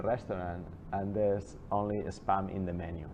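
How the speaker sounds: ordinary talk, nothing marked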